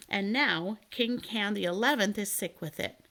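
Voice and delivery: usual speaking voice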